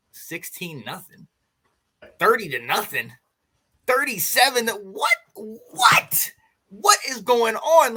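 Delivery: mounting exasperation at a scoreline; fuming disbelief. Retold or imitated. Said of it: "I was sitting there fuming".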